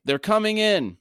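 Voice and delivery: high, carrying call down the slope. A narrator's reading. Hedge calling from the slope to the beach, a loud high call. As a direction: shout it up high so they hear. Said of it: "Hedge called".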